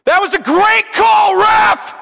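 high, slow, full-voiced shout across the gym; dad shouting over rowdy fans to the ref; loud shout. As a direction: bellow it to drown out the hecklers. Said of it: "as loud as I possibly could".

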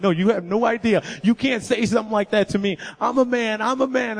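half-shouting through rising tears; retold tearful outburst. A boy telling friends he is a man, shouting tearfully. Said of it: "I start crying and I'm bawling".

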